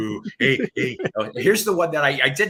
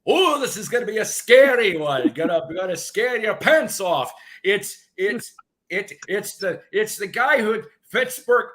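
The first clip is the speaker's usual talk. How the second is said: Uncle Floyd voice; 4 semitones higher, 5 dB louder than usual. Retold or imitated. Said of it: "he goes, as he always goes".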